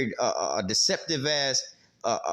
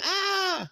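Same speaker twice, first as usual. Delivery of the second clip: mock scream. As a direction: imitate a mom's scream at moderate volume. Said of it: "Mom scream".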